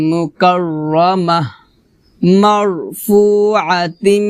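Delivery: slow, measured tilawah with long drawn vowels; Quran recitation. Acted. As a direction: recite for students to copy. Teacher recites Surah Abasa. (ধীরে ধীরে তিলাওয়াত, শিক্ষার্থীদের অনুসরণের জন্য)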